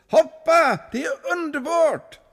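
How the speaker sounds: very high, thrilled shout; excited call. Acted. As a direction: urge him to jump from the water. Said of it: "ropade till munken".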